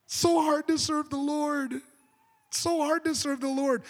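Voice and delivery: high, drawn-out mock lament; hammed-up moaning complaint; mock whining. Mimics complainers about serving God.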